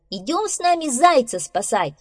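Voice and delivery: loud, high-pitched invitation; rally him with a loud, high call. Acted. Squirrel recruiting beaver to save hare, calling. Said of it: "позвала белка".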